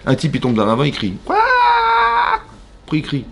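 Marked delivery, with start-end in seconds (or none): 1.2-3.2 a long high stretched cry, much higher; mock falling scream. Teacher acting out a man falling into a ravine, long high cry. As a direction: wail it out like someone falling.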